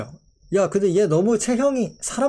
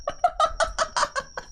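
a squeaky incredulous laugh; high laugh; 5 dB quieter than usual. Real, not acted. Streamer laughing at a Pokémon evolution, high incredulous laugh. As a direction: burst of high-pitched disbelieving laughter.

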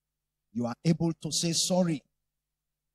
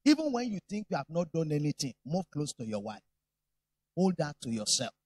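ordinary delivery

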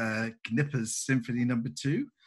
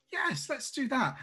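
much higher, delighted yes; eager yes; 12 semitones higher than usual. Acted. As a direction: jump at the plan. Oksana agreeing eagerly.

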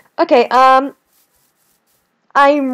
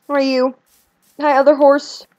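normal talking voice

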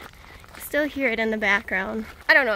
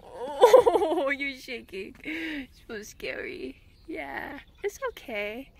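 soothing pet talk; much slower than usual. Real, not acted. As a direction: soothe him gently. Soothing trembling dog.

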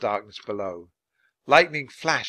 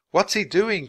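higher, puzzled outcry; mildly alarmed raised pitch; cry; 7 semitones higher, 4 dB louder than usual. A narrator's reading. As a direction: call out a worried question. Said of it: "cried Jack".

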